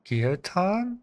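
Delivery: ordinary talk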